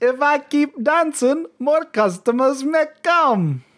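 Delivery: high, slow, mock Japanese-Jamaican sales patter; Japanese-Jamaican accent impression. Podcaster imitating a hot dog seller, Japanese-Jamaican accent. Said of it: "weird Japanese Jamaican accent hybrid".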